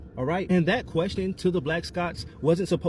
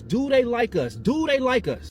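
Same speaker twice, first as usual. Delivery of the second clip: high-pitched, pressing demand; insistant demand; 6 semitones higher, 4 dB louder, slower than usual. Real, not acted. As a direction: press the question hard, pitched up. Insistent repeated question everyone asks.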